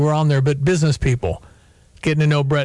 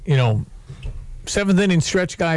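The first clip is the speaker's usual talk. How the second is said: usual speaking voice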